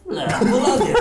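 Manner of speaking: high, laughing Italian-style Lapolandia; mock Italian. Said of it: "To jest Polska po włosku".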